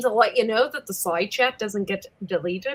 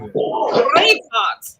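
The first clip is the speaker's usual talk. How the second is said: put-on Scottish accent; 2 semitones lower, much slower than usual.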